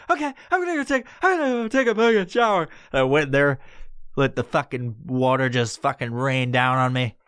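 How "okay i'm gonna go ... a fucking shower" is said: high, squeaky panicked babble; flustered agreement. Retold or imitated. Stoner answering fed-up housemate, flustered. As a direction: squeal it, frantic to comply.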